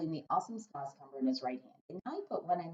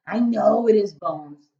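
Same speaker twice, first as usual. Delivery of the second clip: loud, booming friendly giant; a big-hearted giant rumbling away; 4 semitones higher, 12 dB louder, much slower than usual. Acted. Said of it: "the B F G said".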